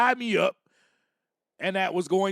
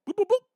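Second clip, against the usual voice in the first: boop noises; 12 semitones higher, 3 dB louder, much faster than usual. Shown as a demonstration. Imitating sound waves.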